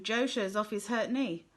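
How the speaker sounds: plain reading voice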